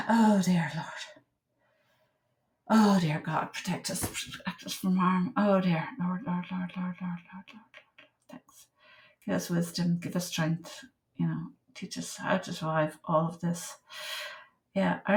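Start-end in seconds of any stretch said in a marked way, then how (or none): 0.1-5.9 soft, hushed prayer under the breath; a murmured plea to the Lord for protection; quiet prayer. Acted. 6.0-8.1 breathy whisper, fading to nothing; repeated whispered Lord, dying away; whispered prayer. Real, not acted. 8.3-13.8 soft, hushed prayer under the breath; a murmured plea to the Lord for protection; quiet prayer. Acted.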